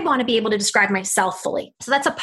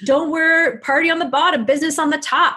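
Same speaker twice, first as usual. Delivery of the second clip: mocking quote; 4 semitones higher than usual. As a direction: toss off the silly rule with scorn. Mocking a common dress habit.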